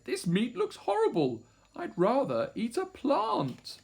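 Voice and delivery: high, fussy little voice; baby dinosaur. A narrator's reading. Third baby turning down meat, high fussy voice. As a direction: protest high, drawing out the end. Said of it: "And he said".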